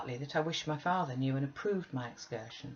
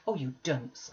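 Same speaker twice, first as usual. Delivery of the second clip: ordinary delivery, nothing marked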